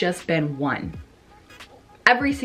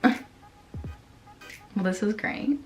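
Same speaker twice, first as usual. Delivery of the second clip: sarcastic; 7 dB quieter, slower than usual. Retold or imitated. Engine light comes on.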